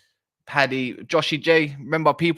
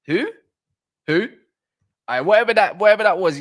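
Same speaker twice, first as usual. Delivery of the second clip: louder, higher exasperated whatever; baffled outburst; 8 semitones higher, 7 dB louder than usual. Real, not acted. Streamer reacts to a bizarre chat comparison, exasperated and loud. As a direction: throw up your hands, loud and high.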